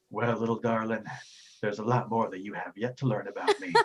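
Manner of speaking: mildly hammed-up suave suitor; lightly suave Cliff Bar. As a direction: play a smooth flirt, lightly put on. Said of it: "far more suave and debonair".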